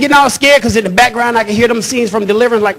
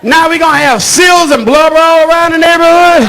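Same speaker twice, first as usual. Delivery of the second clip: mock bigot complaint; 6 semitones higher than usual. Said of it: "looking out the window and go".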